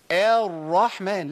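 الرحمن with an over-long opening aa; wrongly lengthened first vowel. Shown as a demonstration. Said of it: "كده جبت حرف مد".

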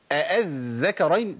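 الذكرين after two hamzas, leaning long on the dh; slow الذكرين with a held double dh; doubled dhal. Shown as a demonstration. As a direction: show the shadda on the first consonant of الذكرين. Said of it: "طب فين المشدد؟".

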